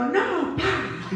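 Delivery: high startled cry of pain; sharp yelp. Retold or imitated. Preacher reenacting her yelp at her sister. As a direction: yelp it out as if your callus was stepped on. Slaps sister after foot stepped on.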